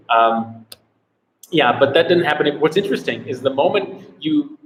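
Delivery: normal talking voice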